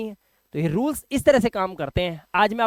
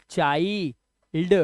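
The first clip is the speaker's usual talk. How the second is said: Child split as "chaai ... ld"; slow breakdown; 2 semitones lower, much slower than usual. Shown as a demonstration. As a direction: hold the vowel in child, pause, then add "ld". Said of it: "तो आई उच्चारण है".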